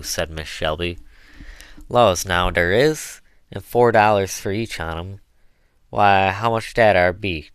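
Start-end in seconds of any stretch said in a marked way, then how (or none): 1.9-7.5 loud, wondering dialect; a hint of an amazed old servant; lightly voiced Chloe, excited. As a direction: marvel at the sum in folksy dialect. Chloe excitedly reckons her wages.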